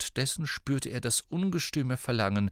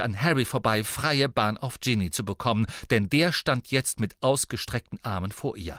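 usual speaking voice

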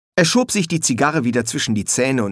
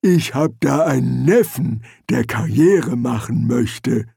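lumber through the words, unbothered; troll; 2 semitones higher, 3 dB louder than usual. Acted. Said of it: "sagte der Troll".

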